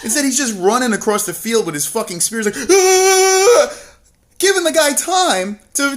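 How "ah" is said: very high, loud hammed-up battle scream; mock war cry. Mocking Theon running across the field, a loud hammed-up scream. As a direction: scream the charge, overdone on purpose. Theon charging again, louder.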